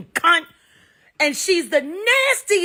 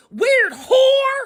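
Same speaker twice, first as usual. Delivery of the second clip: angry shout; 12 semitones higher, 6 dB louder than usual. Real, not acted. Ranting about a rival singer, a sudden angry shout. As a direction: yell the insult, louder and higher.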